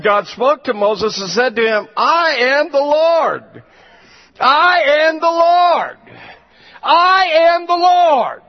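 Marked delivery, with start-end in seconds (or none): 2.0-8.4 slow, building to a high shout; booming proclamation. Acted. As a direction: thunder out God's name, louder each time. God proclaims himself, building.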